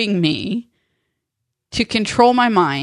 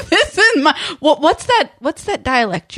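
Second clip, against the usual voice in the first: much higher, giggly mock bafflement; laughing disbelief; 8 semitones higher, 3 dB louder than usual. Teasing nanny about her dialect, laughing in disbelief. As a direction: stammer it out through a laugh.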